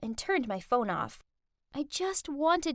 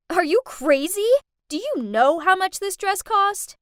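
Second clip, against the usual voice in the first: louder, higher furious scream; 6 semitones higher, 8 dB louder than usual. Acted. Rich girl screaming at the maid, furious and loud. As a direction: rage over the dress. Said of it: "She pushed my mom and screamed".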